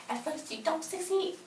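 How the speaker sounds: very high, soft mocking voice; mock singsong. Recapper mocking the quote she tore up, high mock singsong. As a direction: repeat it in a tiny high-pitched voice.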